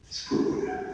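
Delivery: a soft unvoiced whisper trailing away. Preacher to the women, whispering the last try. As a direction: let the word fade into a breath. Third repeat fades to breath.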